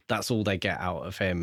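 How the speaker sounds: ordinary delivery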